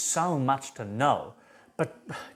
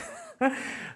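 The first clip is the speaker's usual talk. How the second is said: high breathy laugh; high airy giggle; 14 semitones higher, 4 dB quieter than usual. Real, not acted. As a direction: burst into a silent laugh. Laughs at his own joke.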